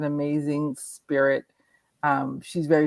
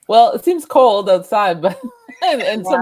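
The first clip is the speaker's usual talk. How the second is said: laughing; 5 semitones higher, 10 dB louder than usual. Real, not acted. Guest laughs while answering.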